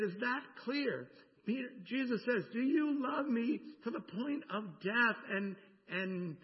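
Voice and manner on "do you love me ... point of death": pressing question. Acted. Jesus asks Peter.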